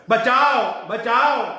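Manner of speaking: put-on girl's loud high cry for help from the bushes. Girl in the bushes crying out for help, loud and high. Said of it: "અચાનક બાજુની ઝાડીમાંથી અવાજ આવ્યો".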